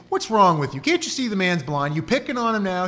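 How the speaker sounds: indignant bystanders. Acted. Bystanders scolding Jesus, acted quote.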